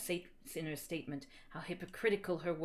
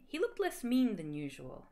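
plain reading voice

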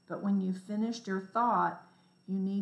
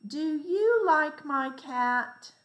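"Do you like my cat?" high on you and rising like a question; slow, high questioning read with the peak on you; asking a question; 7 semitones higher, 5 dB louder, slower than usual. Shown as a demonstration. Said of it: "The second one says".

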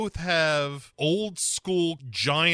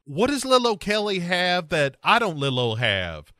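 mock shout; 5 semitones higher, 4 dB louder than usual. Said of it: "Alexa shouts after him".

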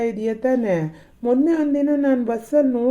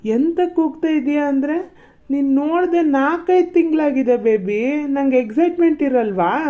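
excited; 6 semitones higher, 3 dB louder than usual. Acted. Boyfriend thrilled to see her.

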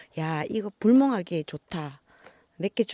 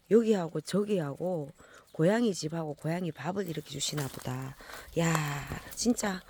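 usual speaking voice

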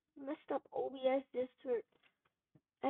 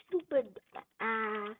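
ordinary delivery

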